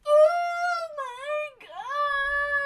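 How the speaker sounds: high, drawn-out squeal. Retold or imitated. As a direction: squeal like a fangirl. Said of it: "everybody's like".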